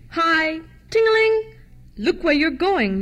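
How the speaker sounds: high, loud street vendor's call; warning cry. A narrator's reading. Ice cream man wheeling his barrow, loud warning cry. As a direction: holler a warning, singing out the bell. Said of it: "gave a warning cry".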